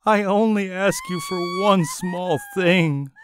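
high whimpering queen; whimpered. A narrator's reading. Tearful queen complains to her husband, whimpering. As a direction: whine it like a hurt child. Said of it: "whimpered she".

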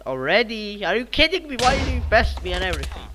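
high, loud indignant outburst; incredulous protest. Real, not acted. Gamer protesting being bested already, loud and indignant. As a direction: rise into a loud, high complaint.

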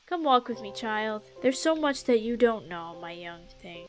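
a hint of a solemn elder; wise old mentor. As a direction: talk like an old teacher sharing secrets. Wise elder lecturing game character.

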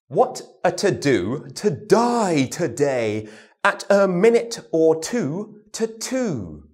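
slow, crisp "to-do to die today"; slow t-d drill. Shown as a demonstration. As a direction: spell out every t and d slowly. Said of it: "Now our t and our does with words".

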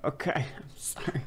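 whispered, laughing apology; sheepish whispered sorry. Real, not acted. Sheepish apology after screen mistake.

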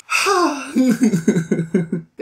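high exhale breaking into chuckles; sighing laugh. Real, not acted. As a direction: let out a "hah" and laugh it off. Laughs about moving on.

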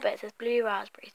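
unvoiced whisper of the label. Real, not acted. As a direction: whisper the flavour name.

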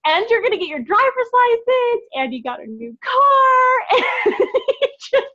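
very high, loud squealing celebration with happy near-tears; shrieking with joy for Carrie; real excitement. Real, not acted. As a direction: gush over a friend's big promotion. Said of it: "I'm so excited".

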